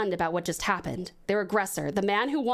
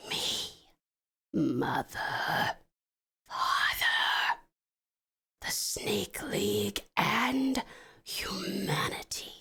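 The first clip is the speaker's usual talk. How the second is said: soft, slow ghostly whisper; 3 dB quieter, much slower than usual. Acted. Ghost Cobra listing those his brother failed, slow whisper. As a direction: drag each name out like a haunting.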